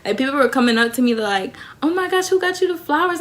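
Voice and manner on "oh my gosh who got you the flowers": high-pitched gushing question; squeal-ask it like an impressed classmate; hyping friend. Acted. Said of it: "they were just hyping me up".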